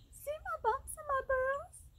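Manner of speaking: very high, tiny voice; high-pitched little vole; squeaking vole. A narrator's reading. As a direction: squeak it all the way through. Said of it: "squeaked the vole".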